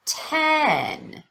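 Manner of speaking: Ten drawn out, high then falling; obvious pitch change. Shown as a demonstration. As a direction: stretch Ten and let its pitch fall. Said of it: "Listen for that change in pitch".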